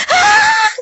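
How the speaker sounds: very high crying shriek; piercing cry of despair; anguished scream. Retold or imitated. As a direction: let out a tormented wail. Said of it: "e ela gritou".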